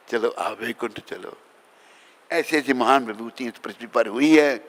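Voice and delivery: normal talking voice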